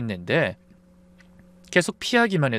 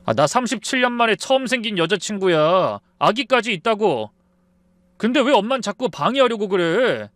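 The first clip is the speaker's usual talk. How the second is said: high-pitched, aggrieved protest; angry outburst; 7 semitones higher than usual. A narrator's reading. Son defending his first girlfriend to mother, angry and aggrieved. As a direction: plead your case angrily. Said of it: "버럭 소리를 질렀습니다".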